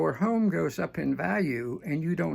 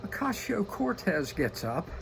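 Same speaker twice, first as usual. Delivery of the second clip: usual speaking voice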